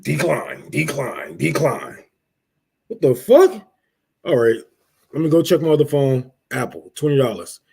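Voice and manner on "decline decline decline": low, soft, robotic repetition; mock card machine. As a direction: flatly intone each decline like a machine. Imitating card reader rejection.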